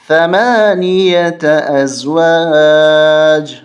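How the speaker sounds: ثمانیة recited as ثمانیةً with a long drawn ending; slow recitation, ثمانیة stretched; recited slowly with pause. Shown as a demonstration. Teacher shows a permitted stop with ثمانیة drawn out. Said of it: "جائز وقف کر سکتے ہیں".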